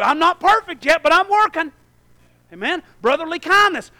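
normal talking voice

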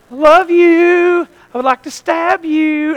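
high, drawn-out fake affection; mock sweetness. Preacher playing two-faced church people, high mock sweetness. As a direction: coo it slowly, then slip in the threat.

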